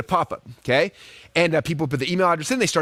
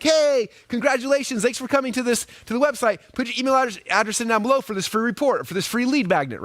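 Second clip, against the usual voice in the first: cheesy pop-up ad; 6 semitones higher than usual. Retold or imitated. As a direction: sell the free report like a pushy pop-up. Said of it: "it would pop up like".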